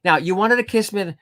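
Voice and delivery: high, loud mom impression; a tipsy mom calling out boldly; mom's voice. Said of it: "And Mom goes".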